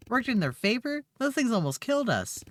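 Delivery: high-pitched, incredulous objection; indignant protest. Acted. Acted argument over killer vegetables.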